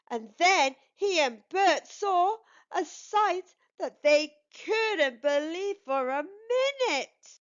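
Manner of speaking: ordinary delivery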